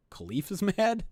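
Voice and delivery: high, rising incredulous question with a laugh; retold surprise, pitch climbing to the end; amused disbelief. Reporter recalling his shock that the player is mad, amused disbelief. Reacting to unexpected anger, retold.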